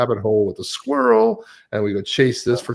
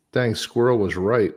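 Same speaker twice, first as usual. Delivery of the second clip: ordinary delivery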